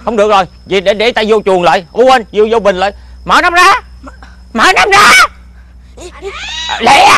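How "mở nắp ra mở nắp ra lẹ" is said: urgent high shout. Acted. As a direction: yell to open the lid fast. Genie urgent, someone coming. (hét gấp, giọng cao)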